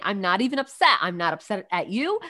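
ordinary talk, nothing marked

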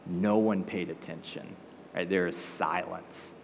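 low, soft, slowing to stillness; hushed. A narrator's reading. Preacher tells the congregation nobody paid attention, hushed. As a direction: drop right down into the silence. Stressing the silence after frenzy.

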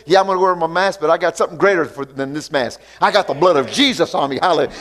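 ordinary talk

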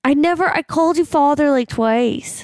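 put-on high whiny kid voice; child voice. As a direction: protest like a little girl. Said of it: "I'm going to play the child".

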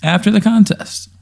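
exasperated. Pretend. Player retorts.

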